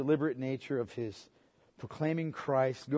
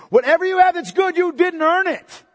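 much higher, louder, slowing on the end; preaching shout; 16 dB louder than usual. Acted. Preacher hammering the point home, near shouting. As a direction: shout it so no one misses it.

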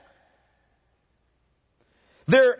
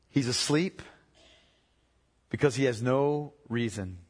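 soft and slow, letting each phrase settle; hushed devotional preaching; 9 semitones lower, 3 dB quieter, slower than usual. Acted. Preacher to the congregation, hushed and slow. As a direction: preach gently, like a bedside reflection. Soft meditative opening on trust.

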